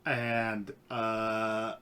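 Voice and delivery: ordinary delivery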